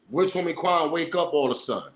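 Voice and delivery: ordinary talk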